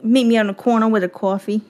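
mock New York accent on coffee and corner. Making plans on the corner, put-on New York accent. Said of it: "New York accent".

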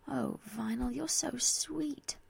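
soft, slow and affectionate; touched, tender. Acted. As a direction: melt over a friend's sweet gift. Touched by the friend's gift.

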